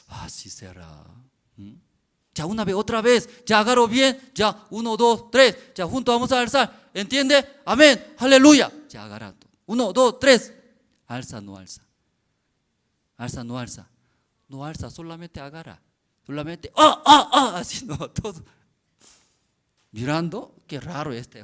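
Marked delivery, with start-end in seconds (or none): 2.3-10.5 high, loud preacher rally, fast in places. Retold or imitated. Pastor rallies the workers with amen and count, loudly. 16.8-17.6 loud high grunts; straining cry. Retold or imitated. Workers heave at the trailer, crying out.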